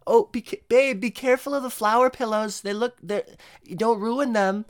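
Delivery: put-on high, fussy girlfriend; a hint of a nagging girlfriend. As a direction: pitch up as the worried girlfriend. Girlfriend voiced again.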